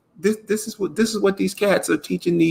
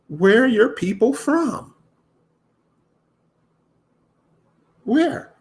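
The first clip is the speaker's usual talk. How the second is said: incredulous; 8 semitones higher than usual. Real, not acted. Challenging the hosts.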